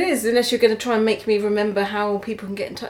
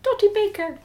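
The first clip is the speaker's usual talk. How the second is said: high Irish leprechaun lilt; chirpy little leprechaun; 8 semitones higher than usual. Acted. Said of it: "in an Irish little leprechaun voice".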